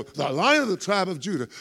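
high, triumphant cry of the title; mock-thrilled shout of the lion title; excited exclaim. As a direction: congregation getting all excited. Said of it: "we would stop there and go".

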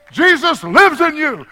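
high, loud preacher's proclamation; triumphant full-force declaration; exclamation-point shout. Shown as a demonstration. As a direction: shout it out emphatically. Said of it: "That was an exclamation point".

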